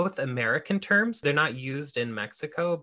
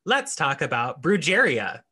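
robot voice; 5 semitones higher, 5 dB louder than usual. Retold or imitated. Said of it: "the automated voice lady going".

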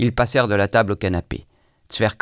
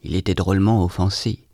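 usual speaking voice

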